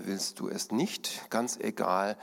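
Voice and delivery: ordinary delivery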